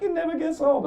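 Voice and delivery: put-on high, sweet older-lady voice; lightly high nurse voice; mock nurse. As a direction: chirp it kindly.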